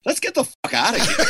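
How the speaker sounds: high-pitched retold quote breaking into laughter; laughing mock panic. Producer turns to his friend wanting to flee, laughing mock panic. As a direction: squeak it out, cracking up.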